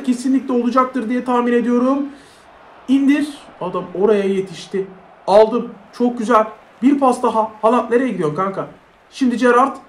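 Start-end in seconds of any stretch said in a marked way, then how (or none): none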